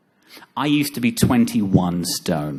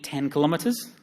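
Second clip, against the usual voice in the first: usual speaking voice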